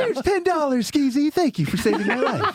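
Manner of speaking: high, hammed-up thanks; jokey imitation of a relieved friend; mock grateful rescued man. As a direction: pitch it up as a play-acted thank-you. Playing the man Skeezy rescued.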